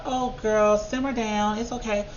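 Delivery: calm her down, sing-song and patronising; patronising hushing voice; mock soothing. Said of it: "And everybody's like".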